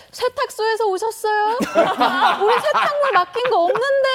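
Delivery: high-pitched mock yelling mother; hammed-up high holler; imitated shout. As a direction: call out loudly and high, like a suspicious mother at the door. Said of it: "이렇게 고함을 쳤다는 거예요".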